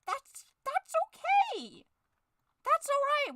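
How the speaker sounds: very high, slow sung okay; manic cheer. Acted. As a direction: cover the anger with a bright high sing-song. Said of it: "Falky's going insane".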